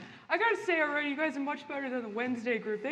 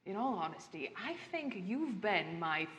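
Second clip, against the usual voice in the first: low, soft, courtly villain voice; quiet menacing host; butler farewell; 5 semitones lower, 4 dB quieter than usual. Acted. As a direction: murmur it low like a sinister host.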